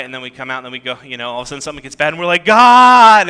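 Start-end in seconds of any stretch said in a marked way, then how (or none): 2.5-3.3 loud, drawn-out mock cry for help; calling out. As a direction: wail up to heaven in exaggerated desperation. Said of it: "And we call out to him".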